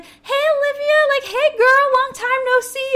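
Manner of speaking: put-on high, loud sing-song valley girl; fake friendly girl. Thief greeting the clerk with a high, sugary, fake friendly voice. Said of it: "and she's like".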